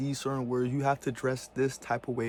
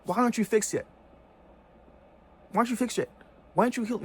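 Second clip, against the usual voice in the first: mock indignant; 7 semitones higher, 4 dB louder, much faster than usual. Rising indignant mock question.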